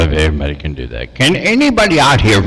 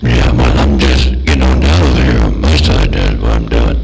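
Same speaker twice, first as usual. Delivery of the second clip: lightly mocking a put-on deep voice; a hint of an impersonated deep voice; 8 semitones lower than usual. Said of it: "And then he said".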